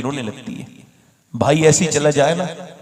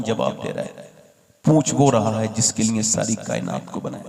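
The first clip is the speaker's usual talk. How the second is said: hushed reflection; 3 semitones lower, 6 dB quieter than usual. Acted. Preacher to congregation, softly asking them to reflect. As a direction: draw them in quietly.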